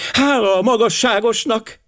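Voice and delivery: high-pitched cry of relief; exclaiming. A narrator's reading. Girl relieved the colonel has his passport, exclaiming. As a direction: cry it out high, without much extra volume. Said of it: "kiáltotta".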